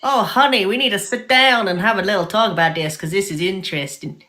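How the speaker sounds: a shifting put-on accent, partly Southern; a mock Southern mom voice that can't settle on a region; wandering accent. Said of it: "what were you trying to be there".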